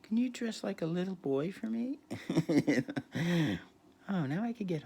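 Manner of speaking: high mock celebrity croon. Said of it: "He went".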